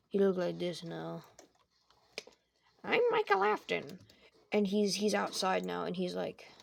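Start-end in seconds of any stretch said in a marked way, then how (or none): 2.8-4.0 silly high voice. Acted. Michael as skeleton introduces himself.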